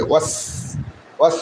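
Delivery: ওয়াস with a long hissed final s; light hissing s. Shown as a demonstration. Teacher shows the light sound, hissing the end of ওয়াস. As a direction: let the s of ওয়াস trail as airy hiss. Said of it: "ফসফস হালকা আওয়াজ বেরোবে".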